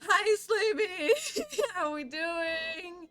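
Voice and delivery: high, loud, laughing hello to a viewer; excited greeting. Real, not acted. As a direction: greet the newcomer with delight.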